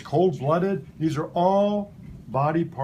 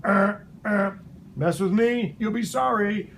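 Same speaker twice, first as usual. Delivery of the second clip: nature's warning voice; 5 semitones higher than usual. Pretend. Nature warns animals to leave the snake alone, raised and threatening. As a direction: play nature daring a predator to attack. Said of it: "It's like nature going".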